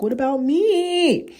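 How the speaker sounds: put-on high, drawn-out child's wail; needy inner child. Inner child begging the overwhelmed adult for attention, high needy wail. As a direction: stretch it out like a pleading little kid. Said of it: "my inner child's like".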